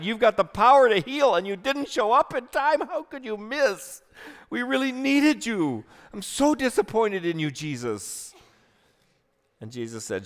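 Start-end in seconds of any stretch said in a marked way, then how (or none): 0.0-8.3 higher, pleading, hurt voice; anguished reproach. Acted. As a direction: cry out your disappointment to Jesus. Sister's anguished reproach of Jesus.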